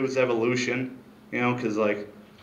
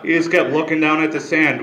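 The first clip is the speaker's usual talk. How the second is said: usual speaking voice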